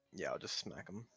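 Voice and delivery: ordinary delivery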